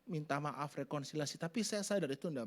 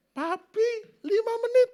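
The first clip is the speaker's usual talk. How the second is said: put-on high, loud little girl's cry; child's voice; 12 dB louder, slower than usual. Youngest daughter reminding dad of the rule, high child voice. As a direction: squeal it like a small daughter. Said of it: "anak saya paling kecil".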